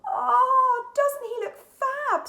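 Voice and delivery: very high, loud, slow cooing; a girl adoring the cat in a spacesuit; gushing. A narrator's reading. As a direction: coo over the cute cat. Girls cooing at dressed-up cat.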